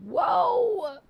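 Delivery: high, sudden burst of surprise; startled yelp at a huge number; surprised whoa. Real, not acted. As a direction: let it jump right up in amazement. Reacting to big bits badge.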